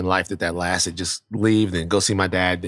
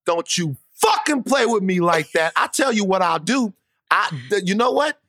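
higher-pitched indignant fatherly rant; outraged dad; 7 semitones higher than usual. Retold or imitated. Dad vows to confront the principal, high and indignant. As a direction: imitate a riled-up father laying down the law.

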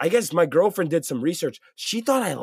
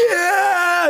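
high, loud whoop; crowd cheer; 7 dB louder than usual. Retold or imitated. Crowd goes crazy.

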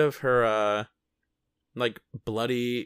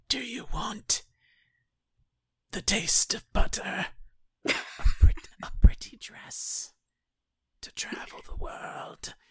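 breathy tempting whisper; devil whisper; 10 semitones higher than usual. Retold or imitated. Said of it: "devil A S M R".

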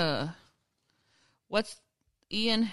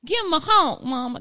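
put-on high, loud Southern little-girl twang; Southern toddler accent; 11 semitones higher, 11 dB louder than usual. Host repeating the toddler's line, in a loud Southern accent. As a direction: echo the kid's Southern honk cry. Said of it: "Where did that accent come from?".

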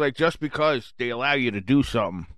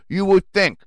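indignant rant; 7 semitones higher, 3 dB louder than usual. Real, not acted. Ranting about cops.

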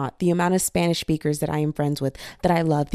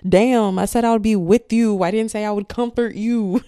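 louder, higher exasperated retort; sassy scolding; 4 semitones higher, 7 dB louder than usual. Acted. Pet Connie rejecting crying owner, sassy scolding. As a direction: scold with loud mock exasperation.

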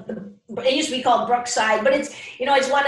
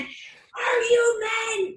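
high, slow bellowed challenge; raging coach; 11 semitones higher, much slower than usual. Retold or imitated. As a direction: roar it like a coach losing it at halftime. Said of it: "just going nuts".

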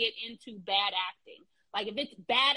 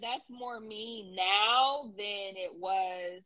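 drawn out; much slower than usual. Retold or imitated. Quoting her own text, drawn out.

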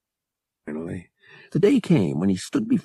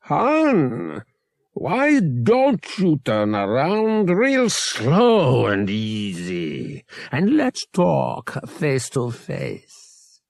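higher, very slow, a chuckle behind each word; chuckling genial captain; 4 semitones higher, much slower than usual. A narrator's reading. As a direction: drawl the threat slowly and pleasantly. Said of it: "The genial tones held a chuckle now".

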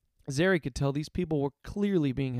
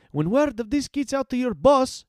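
high, loud taunt; defiant yell; 11 semitones higher, 5 dB louder than usual. A narrator's reading. Heroine draws guards away from hostages, yelling. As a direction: yell the threat across the fight. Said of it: "she yelled".

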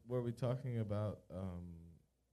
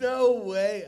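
higher, much louder drawn-out protest; loud disbelief; 8 semitones higher, 20 dB louder than usual. Real, not acted. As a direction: cry out in slow, incredulous denial. Disbelieving outburst at a quote.

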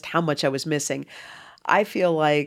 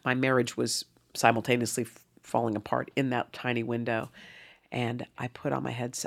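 normal talking voice

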